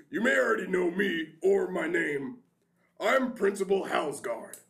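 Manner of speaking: grand welcoming address; formal principal speech. Acted. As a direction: address the new class proudly.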